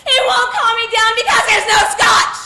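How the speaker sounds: loud, crying protest; on the verge of tears, pushing back hard; tearful outburst. Acted. Wife near tears, refusing drink.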